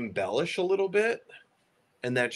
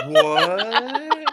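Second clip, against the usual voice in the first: baffled; 7 dB louder than usual. Retold or imitated. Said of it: "We're like".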